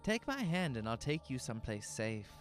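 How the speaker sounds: lightly voiced calm man; a hint of a kindly stranger; reassuring stranger. A narrator's reading. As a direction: lure him gently with false kindness.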